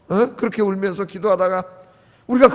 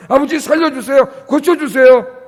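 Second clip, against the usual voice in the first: tearful plea; 5 semitones higher, 6 dB louder than usual. Shown as a demonstration. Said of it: "펑펑 울면서 기도했고".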